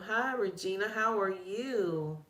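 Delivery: ordinary delivery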